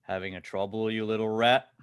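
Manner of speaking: loud taunt, swelling toward the end; big guy taunting. Acted. Big guy in the corner baits the stammerer, taunting. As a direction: lean hard into the final insult. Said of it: "big guy in the corner".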